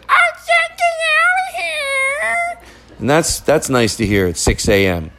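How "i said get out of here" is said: very high, stretched-out parrot squawk; bird mimicking mom. Cockatoo copies mom yelling at dogs, high squawk. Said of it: "then the bird goes".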